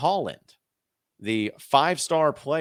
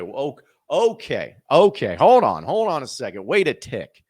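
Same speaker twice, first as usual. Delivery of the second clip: mock double take; 3 semitones higher, 6 dB louder than usual. Fans' shocked reaction retold.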